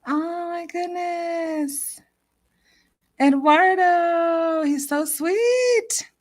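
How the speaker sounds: high, slow gushing over him; touched gushing. Real, not acted. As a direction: melt over how sweet he is. Touched after guest leaves.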